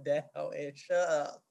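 laughing through a mock tough talk; blunt older woman. Older women telling themselves off, mock tough and laughing. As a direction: lay down the law, half laughing. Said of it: "They like".

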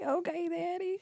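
high, tiny, soft submissive voice; mock-coy little squeak; turned on. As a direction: play it meek and flirty. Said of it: "I'd be so turned on".

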